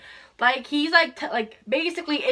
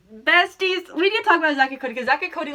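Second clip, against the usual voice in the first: excited; 5 semitones higher, 5 dB louder, faster than usual. Real, not acted. Excited call to viewers.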